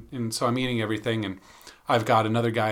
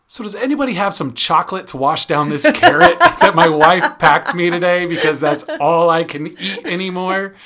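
laughing, raised voice begging the office for chocolate; loud joking plea; 6 semitones higher, 9 dB louder than usual. Retold or imitated. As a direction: call out to the whole office, half laughing. Joking appeal to office coworkers.

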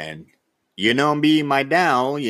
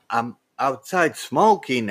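normal talking voice

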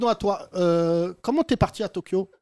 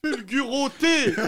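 cri d'attaque de dessin animé, aigu et étiré; parodie de Goldorak arabe; 4 semitones higher, much slower than usual. Pretend. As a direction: lance l'attaque en traînant, entre parodie et rire. (parodie de héros animé, rieuse et perchée)

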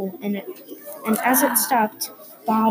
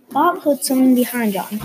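ordinary delivery, nothing marked

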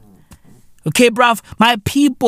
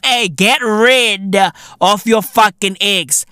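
ordinary talk, nothing marked

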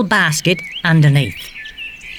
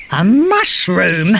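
punchline laughing; slower than usual. Real, not acted. Punchline of riddle.

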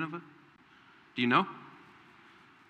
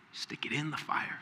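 whisper; 8 dB quieter than usual. Acted. Preacher drops to whisper.

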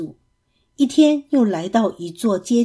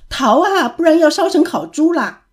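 higher, louder panicked cry; shouting; 7 semitones higher, 4 dB louder than usual. A narrator's reading. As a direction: yell to flee the fire, hammed up. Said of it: "八戒见了大叫".

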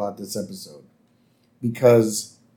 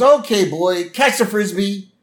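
high, eager playful call; acted upbeat cartoon call; calling to a dog; 8 semitones higher than usual. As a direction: call out brightly to your dog. Bart calling to his dog.

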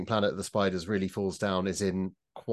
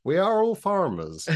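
high, loud mock West Country farmer; West Country accent; 11 semitones higher, 4 dB louder than usual. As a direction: ham up a West Country yokel. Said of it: "doing West Country accents".

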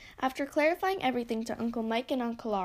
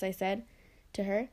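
ordinary delivery, nothing marked

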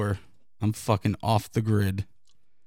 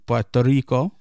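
fake Spanish accent; 3 dB louder than usual. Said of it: "when you do fake accents".

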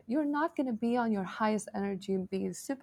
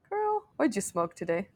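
laughing; 6 semitones higher, 3 dB louder than usual. Retold or imitated. Imagined reply, laughing.

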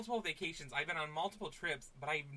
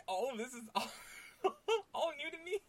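real laughter breaking through, higher pitch; holding back laughter; 11 semitones higher than usual. Real, not acted. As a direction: talk while cracking up. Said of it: "not laugh this entire fucking time".

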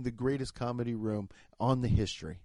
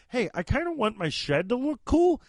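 lightly voiced customer, pitched up; breezy ask in a put-on voice; a hint of a casual homeowner asking; mock customer voice; 9 semitones higher than usual. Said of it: "you could be like".

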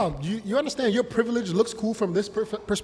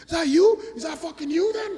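high, louder mock challenge; mock shout; 10 semitones higher, 5 dB louder than usual. Drunk man challenges the comedian outside, high loud mock shout.

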